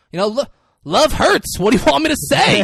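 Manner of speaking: loud, high retold shout; mock yelling. Imitating the client yelling after Marty as he leaves. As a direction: call it after someone walking away. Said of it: "and he's screaming this".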